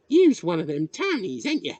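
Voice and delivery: put-on high, loud country-boy voice; village boy. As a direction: challenge him like a nosy local lad. Village boy questions the newcomer.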